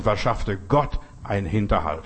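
ordinary talk

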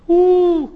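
high, loud "ooh" of praise; exultant whoop. Real, not acted. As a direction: let out a sudden piercing whoop. Exultant whoop during scripture reading.

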